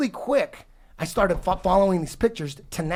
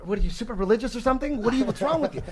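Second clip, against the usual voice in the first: retold challenge, getting higher with each question; incredulous questioning; 6 semitones higher, faster than usual. Podcaster retelling how he questioned his buddy Manny, incredulous. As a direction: pitch climbing in disbelief.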